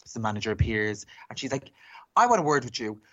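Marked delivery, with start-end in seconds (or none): 2.2-3.0 higher-pitched, emphatic mimicked outrage; imitated indignant complaint. Drag queen complaining to cinema manager, imitated indignation. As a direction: act out an affronted customer complaining.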